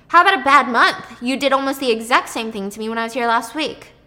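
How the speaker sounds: loud, scolding retort. Retold or imitated. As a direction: raise your voice to scold her. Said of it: "And Nick raises his voice".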